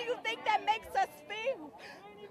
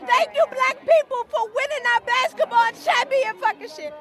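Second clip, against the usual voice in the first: shouted protest; 2 semitones higher, 7 dB louder than usual. Real, not acted. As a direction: hurl every thank you at the police line like a chant. Rising shouted protest speech.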